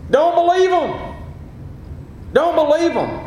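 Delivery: ordinary talk, nothing marked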